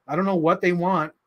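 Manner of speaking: ordinary talk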